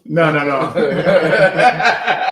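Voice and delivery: loud burst of laughter on a refusal; laughing refusal. Real, not acted. Turning down a colleague's suggestion of a video, laughing out loud. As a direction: refuse loudly, breaking into laughter.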